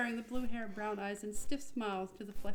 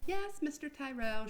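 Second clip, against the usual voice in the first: slow, higher polite reply; polite answer; 6 semitones higher than usual. Acted. Receptionist answering her boss, lightly polite. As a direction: answer sweetly, slowly. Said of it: "she answered politely, benignly".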